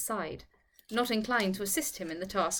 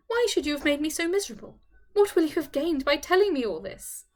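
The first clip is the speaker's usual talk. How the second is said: high, loud, wretched appeal; miserable, voice raised; distressed reproach; 6 semitones higher, 4 dB louder than usual. A narrator's reading. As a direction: plead for a reason, upset. Tearful reproach after weeping.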